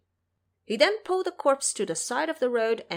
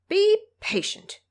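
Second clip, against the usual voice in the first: reassuring; 10 semitones higher, 6 dB louder, slower than usual. Acted. Xingzhe calms anxious monks.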